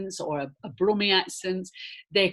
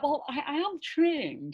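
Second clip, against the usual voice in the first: higher and slow, mildly affronted; a touch of wounded pride, set them straight; indignant retort; 9 semitones higher, slower than usual. Retold or imitated. Actor answering casting directors surprised she can do RP, mildly indignant. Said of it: "I was a bit like".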